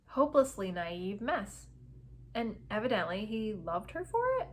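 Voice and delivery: ordinary delivery, nothing marked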